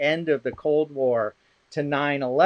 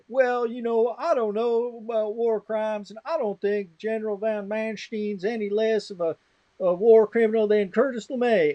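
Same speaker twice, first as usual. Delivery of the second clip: higher-pitched mocking impression of a committee member; pompous objector; 6 semitones higher than usual. Committee member objecting at a PhD defense, mimicked in a high, put-on voice.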